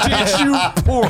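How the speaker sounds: slow, high, laughing tease. Real, not acted. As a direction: drag it out through laughter. Repeated teasing, laughing.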